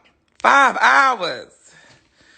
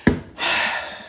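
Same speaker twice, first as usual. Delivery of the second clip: long voiceless breath out; nervous sigh; 3 dB quieter than usual. Real, not acted. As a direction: exhale to steady the nerves. Bracing to open the box.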